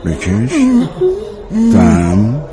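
slow, drawn-out breathe-in, breathe-out cues; breathing cues. Acted. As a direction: stretch each word to pace a patient's breath. Doctor paces the patient's breathing. (شمرده و کشیده، راهنمای نفس کشیدن)

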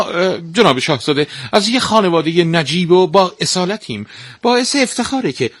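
high, fawning and sugary, overdoing the praise; butter up the prince in a squeaky high voice; the stepmother flatters the prince to win him, high and gushing; flattering stepmother. Acted. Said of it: "با چاپلوسی گفت".

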